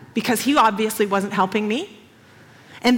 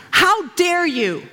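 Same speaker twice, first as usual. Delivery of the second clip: higher and slow, outraged; mock indignant; 6 semitones higher, slower than usual. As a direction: scold the mafia men with indignation. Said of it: "they were gonna get all of it".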